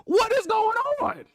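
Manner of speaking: high, incredulous repeat; incredulous squeak of disbelief. Retold or imitated. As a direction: pitch it up, stunned. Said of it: "I said".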